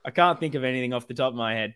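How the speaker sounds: ordinary talk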